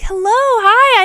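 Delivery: high, loud, slow sugary greeting; overly sweet to ignore her hostility; fake cheerful. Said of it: "pretend like I don't even notice her negative energy".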